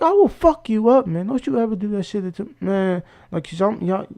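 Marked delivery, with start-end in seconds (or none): 0.0-3.0 high-pitched imitation of angry threats; retold angry threat. Imitating the boyfriend warning him off, high mock anger. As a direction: act out his threat, voice pitched up.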